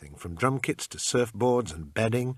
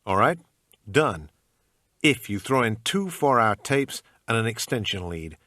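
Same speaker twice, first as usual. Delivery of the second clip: bad American accent; 2 semitones higher, 4 dB louder than usual. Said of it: "in my usual bad American accent".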